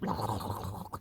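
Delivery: low, soft rattling snore noise; imitated dog snore. Owner showing how the pug sleeps, mimicked snoring.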